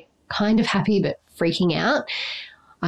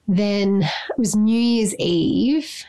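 normal talking voice

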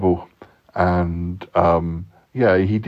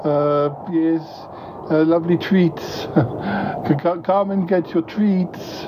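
coaxing cat call; 7 semitones higher, slower than usual. Acted. Luring the cat with treats.

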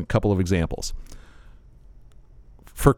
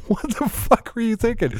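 much higher, exasperated; mock outrage; 11 semitones higher than usual. Confronting his wife about the surprise, exasperated. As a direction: act stunned at your wife. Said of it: "I'm like".

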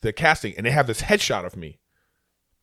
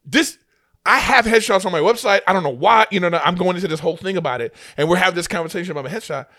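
higher, louder rant that speeds up; heated rant; 5 semitones higher, 4 dB louder than usual. Retold or imitated. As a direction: rant faster and faster about the headshot. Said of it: "I go".

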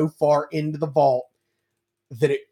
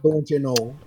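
put-on Wisconsin accent. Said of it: "Wisconsin accent".

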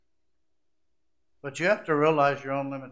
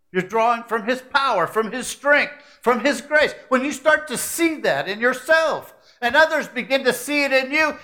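building preaching; 7 semitones higher, 6 dB louder than usual. Acted. Preacher lifting the congregation, climbing to a peak. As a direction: pitch and force climb phrase by phrase.

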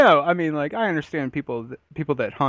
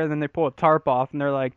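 usual speaking voice

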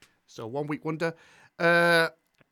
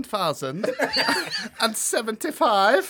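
laughing; 7 semitones higher, 5 dB louder than usual. Real, not acted. Host laughing through the intro.

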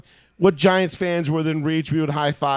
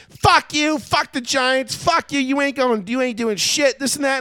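much higher, imitating her shrill rant; mock shrieking girl; 9 semitones higher than usual. Fan mimics a rival girl cursing at him, much higher. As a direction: squeal it out like the angry girl.

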